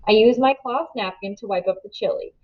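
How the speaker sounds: plain reading voice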